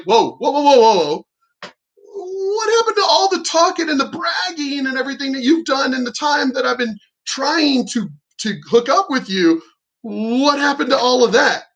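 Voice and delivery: hammed-up stunned teasing; incredulous whoa. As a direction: throw her bragging back at her in a high squeaky voice. Mocking her earlier bragging.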